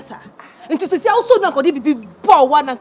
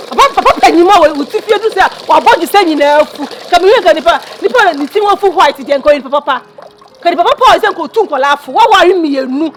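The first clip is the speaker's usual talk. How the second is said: angry rant; 7 dB louder than usual. Acted. Heated quarrel between the two.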